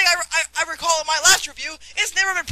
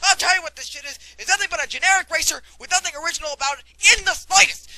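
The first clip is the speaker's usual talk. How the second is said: ordinary talk, nothing marked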